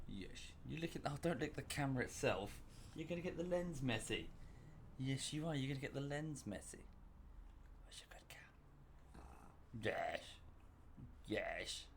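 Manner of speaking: very soft cooing to a dog; doting pet talk. Real, not acted. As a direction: gently fuss over the dog, barely above a murmur. Fussing over dog at camera.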